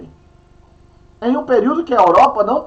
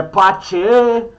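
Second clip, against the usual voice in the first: "patê" with a gaúcho "tch", pat-CHEH, in a gaúcho accent. Shown as a demonstration. Teacher showing how southerners say it, gaúcho accent. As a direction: say "patê" like a gaúcho from Rio Grande do Sul, "pat-chê". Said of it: "no Rio Grande do Sul fala".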